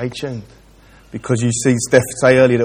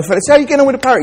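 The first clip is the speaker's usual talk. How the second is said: much higher, fast chirpy shopkeeper; 12 semitones higher, 3 dB louder, much faster than usual. Retold or imitated. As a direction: rattle it off high and quick. Shopkeeper asks, voiced.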